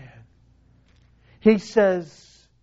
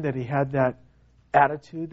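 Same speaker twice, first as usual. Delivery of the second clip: ordinary talk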